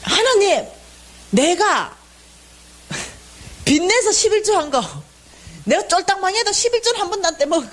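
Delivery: high-pitched aggrieved listing of good deeds; railing at God. Retold or imitated. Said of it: "하나님한테 난리가 난 거예요". (억울해서 높은 목소리로 조목조목 따지듯)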